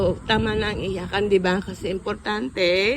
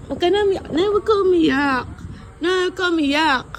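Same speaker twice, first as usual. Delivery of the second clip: much higher, coaxing voice begging her not to cry; pleading comfort; 10 semitones higher, faster than usual. Real, not acted. Child comforts crying mother in hospital, high coaxing plea.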